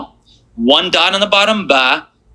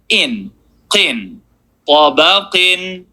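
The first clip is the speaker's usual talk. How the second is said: slow demo reading; much slower than usual. Shown as a demonstration. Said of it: "If you're not getting the sound that I'm doing".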